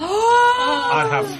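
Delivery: slow, high triumphant shout; excited shout. Real, not acted. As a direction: announce it like a big reveal. Player excitedly introduces wizard puppet.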